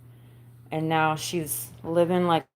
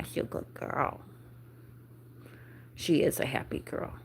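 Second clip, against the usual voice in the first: whispered praise; 3 semitones lower, 10 dB quieter than usual. Real, not acted. Soothing the dog.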